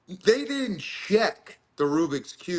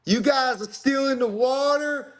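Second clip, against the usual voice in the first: high-pitched mock women's voice; put-on high voice, retelling; imitating women competitors; 10 semitones higher than usual. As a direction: call it out high, as a heckling onlooker. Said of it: "two women, and they're like".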